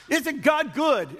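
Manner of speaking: high, slow rising call of praise; pastor's call. Retold or imitated. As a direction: stretch it out high like a preacher rallying the room. Pastor calls to congregation.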